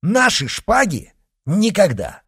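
flatly refuse, ringing; loud outraged refusal; defiant refusal. Acted. Refusing to surrender swords.

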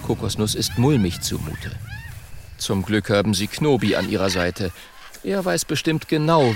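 ordinary delivery

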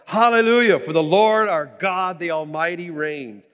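loud, high jubilant proclamation; crying out in praise. Acted. Preacher reads the multitude's praise to the congregation, crying out. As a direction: cry it out like a great multitude. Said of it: "crying out".

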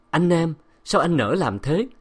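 wronged wife's plaintive question; anguished reproach. A narrator's reading. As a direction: ask in hurt disbelief. Abandoned wife reproaches absent husband.

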